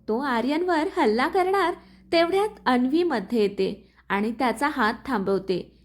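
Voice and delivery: usual speaking voice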